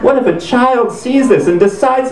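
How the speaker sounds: high, slow, hammed-up host; exaggerated moral alarm; mock anguished plea. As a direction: imitate someone overcome with concern. Mimicked host's emotional outburst.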